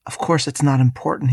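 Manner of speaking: ordinary talk, nothing marked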